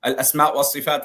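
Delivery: ordinary delivery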